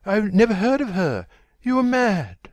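high, loud, climbing protest; panicked denial. A narrator's reading. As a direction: deny it desperately, pitch rising. Terrified denial at gunpoint.

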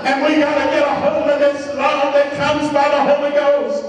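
high-pitched, impassioned preacher; fervent preaching. Real, not acted. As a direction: build into full-force revival preaching. Preaches at full force.

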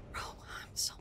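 hushed, breathy whisper to yourself; whispered frustration. Real, not acted. Streamer whispering to herself to calm down mid-game.

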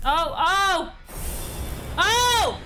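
overplayed tearful protest; hammed-up crying. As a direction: melodramatic sob, way up high. Character cries to mother in game.

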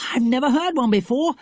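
put-on high, eager young adventurer; thrilled child, pitched well up; excited Jack. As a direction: urge a friend on excitedly. Story dialogue as Jack.

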